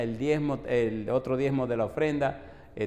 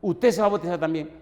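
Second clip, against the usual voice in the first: stern demand; 4 semitones higher, 7 dB louder, faster than usual. Retold or imitated. Pressing the wife.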